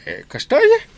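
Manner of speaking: a shocked high yelp of disbelief; jumping up in pitch at the news; surprised. Acted. Shocked at the reply.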